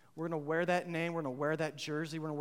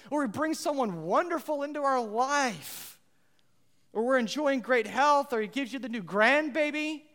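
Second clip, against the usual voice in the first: loud, high, driving sermon delivery; rally the congregation; fired-up preaching; 7 semitones higher, 7 dB louder than usual. Acted. Preaching at full force.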